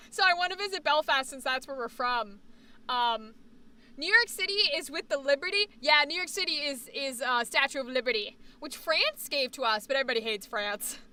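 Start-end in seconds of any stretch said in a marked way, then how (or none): none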